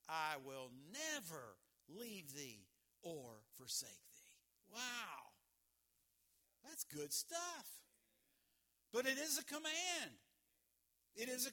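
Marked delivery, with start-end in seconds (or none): 0.1-4.7 tender verse reading. Real, not acted. Quoting Jesus' promise tenderly. 4.7-7.8 savoring aside. Real, not acted. Marveling at the verse.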